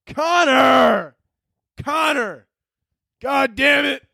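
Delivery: high-pitched hollered scolding; angry dad yelling. Acted. Dad yelling at his son, angry high holler. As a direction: holler for the kid with drawn-out vowels. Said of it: "his dad starts yelling at him".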